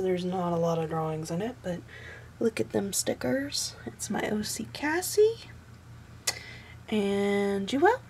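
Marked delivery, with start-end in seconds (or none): 3.8-8.0 whispered aside. Real, not acted. Pointing out her OC sticker, she whispers excitedly. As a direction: hush it out like a little secret.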